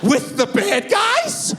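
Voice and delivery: put-on a frightened boy's shout; scared kid. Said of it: "He goes".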